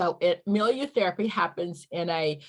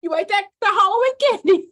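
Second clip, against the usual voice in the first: higher and louder, teasing call-out; mock student scolding; 12 semitones higher, 9 dB louder than usual. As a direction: call out the teacher. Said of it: "you're gonna say".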